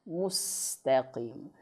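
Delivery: Mustaqim slowly, lips pushed forward on mus; teacher's correct model; correct rounded u. Shown as a demonstration. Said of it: "Harusnya u".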